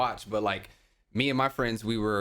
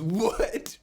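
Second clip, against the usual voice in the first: shocked friend; 8 semitones higher than usual. Retold or imitated. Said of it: "He goes".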